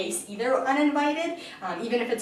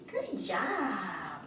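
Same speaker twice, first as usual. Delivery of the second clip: soft praise; 9 dB quieter than usual. Real, not acted. Calm praise for the puppy.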